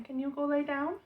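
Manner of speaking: high sweet request to a pet; asking the dog. Real, not acted. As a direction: coax the dog to lie down.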